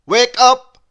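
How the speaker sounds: shouted, high and loud; a shout to wake someone up; loud wake-up call. A narrator's reading. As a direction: blast it to wake a sleeper. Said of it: "said the noise".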